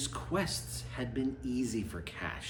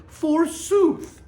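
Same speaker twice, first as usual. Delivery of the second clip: forsooth, sung out high and loud like a grand queen; a mock-regal forsooth; queenly forsooth; 14 semitones higher, 7 dB louder than usual. Narrator voicing the queen's speech, mock-regal. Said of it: "She says queenly things like".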